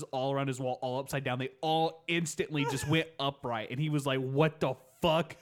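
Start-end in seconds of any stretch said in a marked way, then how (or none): 4.2-5.4 loud, slow baffled outrage; hammed-up stunned swearing; mock freak-out. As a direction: draw it out in mock disbelief. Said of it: "he just lost his shit".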